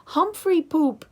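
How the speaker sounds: loud, high, slow giggly yell; little boy yelling while cleaning the cage. A narrator's reading. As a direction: shout it like a delighted toddler. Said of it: "he yelled".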